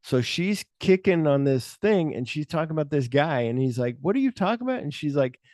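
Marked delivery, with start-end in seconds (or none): 4.0-4.8 high puzzled question, lightly voiced officer; mock confusion. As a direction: pop it up high, baffled.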